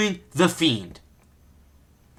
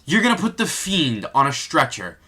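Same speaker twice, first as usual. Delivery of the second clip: ordinary talk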